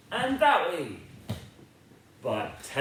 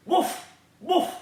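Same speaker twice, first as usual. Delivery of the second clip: high, playful woof woof; a big friendly dog barks; 11 semitones higher, 3 dB louder than usual. Acted.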